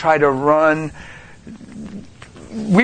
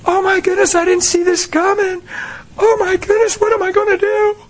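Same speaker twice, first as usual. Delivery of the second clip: high, panicky wail; panicked mock fret; 12 semitones higher than usual. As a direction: act out a frantic believer. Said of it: "here's what Christians do".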